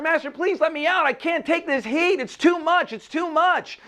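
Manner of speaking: very high, panicked begging; screamed plea. A narrator's reading. Teacup in the oven to its master, high screamy plea. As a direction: cry out desperately to be released. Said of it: "I just kept screaming to my master".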